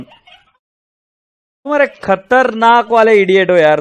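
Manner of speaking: high, loud and slow insult; exasperated scolding. Real, not acted. Teacher hanging up on student, slow loud angry scold. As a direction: drag out every word in disgust. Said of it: "मैं डांट रहा हूं".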